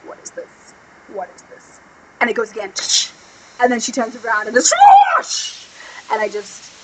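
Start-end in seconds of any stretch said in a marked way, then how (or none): 0.0-1.8 acted, very soft whisper; hushed whisper. Voicing a character in hushed discovery. 5.2-5.7 airy voiceless whoosh; imitated whoosh.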